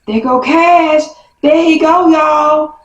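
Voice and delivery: high, drawn-out call across the yard; yelling out. Retold or imitated. Sister alerting the girls that Cash is coming, yelling out. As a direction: imitate a sister calling out a sighting, high-pitched. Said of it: "my sister Lele yells".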